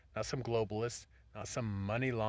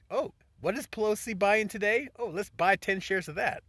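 mocking greedy investor; 10 semitones higher, 10 dB louder than usual. Mocking investors copying Pelosi.

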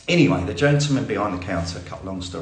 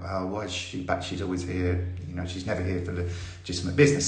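softer, snide muttering from the queue; mock sniping; 5 semitones lower, 7 dB quieter than usual. People in the queue, lightly mocking snipes. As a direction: gossip behind her back. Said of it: "the usual attitude behind me".

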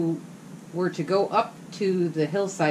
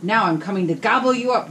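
louder threatening roar; troll roar; 4 semitones higher, 6 dB louder than usual. Acted. Troll roaring at the big goat, louder. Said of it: "roared the troll".

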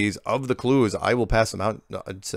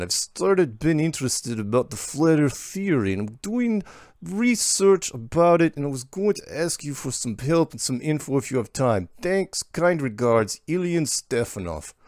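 higher-pitched, put-on Russian accent; terrible Russian accent; 6 semitones higher than usual. Host reading Ilian's request for help, terrible Russian accent. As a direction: read it in a badly faked Russian accent. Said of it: "I got to do my accent".

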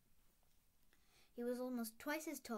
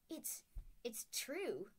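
faltering; 3 semitones higher, much slower than usual. A narrator's reading. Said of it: "faltered Professor McGonagall".

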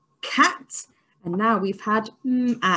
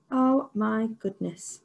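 ordinary talk, nothing marked

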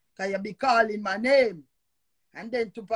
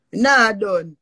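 defiant shout; 9 dB louder than usual. Real, not acted. Refusing to stop ranting.

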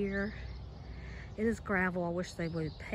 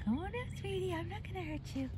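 coaxing; 11 semitones higher, 8 dB quieter than usual. Real, not acted. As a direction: gently coax a tiny creature in a high little voice. Coaxing a small bird.

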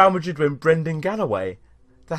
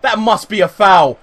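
higher, louder insistence on a foul; indignant protest; 5 semitones higher, 7 dB louder than usual. Real, not acted. Gamer appealing for a foul not given, indignant raised voice. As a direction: protest it loudly at the referee.

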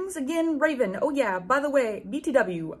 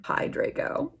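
soft, whispered mopey greeting; depressed tone; 4 dB quieter, slower than usual. Shown as a demonstration. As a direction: sigh it out, all gloom and no energy. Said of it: "Let me do that again".

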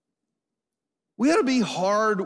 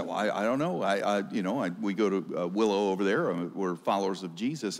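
sheepish mumble; 9 semitones lower, 6 dB quieter than usual. Retold or imitated. Timid applicant voiced in story.

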